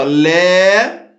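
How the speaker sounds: "lé" drawn out with a rising end; teacher stretching "lé" while dividing the word; isolated syllable. Shown as a demonstration. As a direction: hold "lé" long as a syllable on its own. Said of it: "que eu ia separar".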